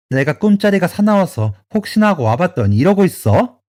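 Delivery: mother-in-law berating her son, furious; furious tirade. Acted. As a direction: tear into him with outrage.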